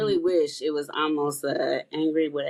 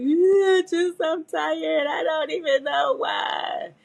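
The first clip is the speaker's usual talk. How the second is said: mock tearful wail; 11 semitones higher, slower than usual. Answering husband while crying, high slow wail. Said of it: "And I'm like".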